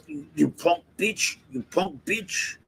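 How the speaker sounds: a hushed, understated echo of the angry guy; muttered retake. Retold or imitated. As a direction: say the insults again quietly, half to yourself. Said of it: "one more time".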